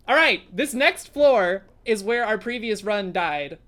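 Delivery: slow, loud, drawn-out resolve; slow, loud pumped-up vow; 1 determined hype. Real, not acted. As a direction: build up the next floor with grit. Hyping up the next floor.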